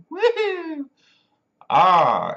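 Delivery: put-on high, loud drunken whoop; hammed-up boozy holler; drunk person. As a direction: imitate a rowdy drunk cheering. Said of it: "when they get alcohol in them".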